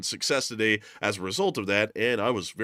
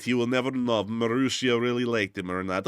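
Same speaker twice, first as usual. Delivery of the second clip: ordinary delivery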